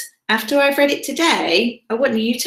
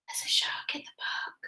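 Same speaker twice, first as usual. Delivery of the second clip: very soft, whispered repeat; hushed echo; 15 dB quieter than usual. Acted. Reader repeats the shark warning after the yell, hushed echo. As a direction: whisper the alarm under your breath.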